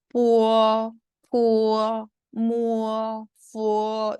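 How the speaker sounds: 뿌어, 푸어, 무어, 푸어 each drawn out on a level pitch; rounded-lip pronunciation. Shown as a demonstration. Teacher models labials for students, rounded, held 뿌어, 푸어, 무어, 푸어. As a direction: glide with round lips through 뿌어, 푸어, 무어, 푸어. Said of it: "입 모양을 동그랗게 벌리면서".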